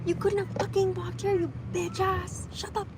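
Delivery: mock angry yell at a driver; mock road rage. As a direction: fake road rage at the golf cart. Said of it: "Just kidding".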